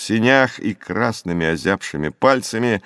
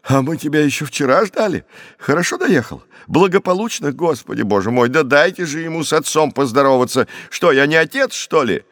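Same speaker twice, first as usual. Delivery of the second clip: high-pitched, fussing, excited welcome; a flustered father crowding in to greet his son; flustered cry; 7 semitones higher than usual. A narrator's reading. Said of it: "закричал испуганно".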